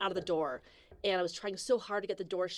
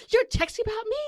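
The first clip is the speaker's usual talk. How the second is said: high, loud mock indignant question; mock offended passenger; 13 semitones higher, 5 dB louder than usual. Passenger catches her texting, mock indignant. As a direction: play the nosy passenger caught out. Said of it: "she's like".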